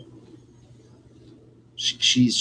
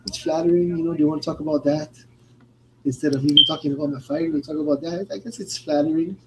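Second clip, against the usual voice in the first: lightly imitated, higher-pitched interviewee; a hint of a higher put-on voice; Mackenzie Dern; 5 semitones higher than usual. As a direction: quote her in a slightly raised, quicker voice. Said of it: "She goes".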